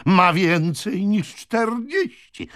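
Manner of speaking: high, slow, contemptuous retort; scornful exposure. A narrator's reading. Brother reveals his sister's true age, scornfully. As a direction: crow it out, dragging the words.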